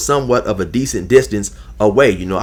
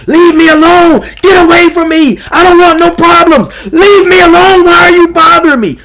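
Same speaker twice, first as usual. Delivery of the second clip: much higher, much louder hysterical yelling; mock screaming; 10 dB louder than usual. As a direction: scream it at the top of your lungs. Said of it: "screaming and hollering at the top of his lungs".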